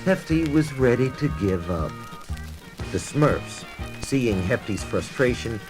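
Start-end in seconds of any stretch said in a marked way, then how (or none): none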